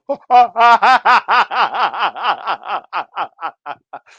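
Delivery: loud cackling burst of laughter; big loud laugh. Real, not acted. Dad bursting into loud laughter at his son's joke.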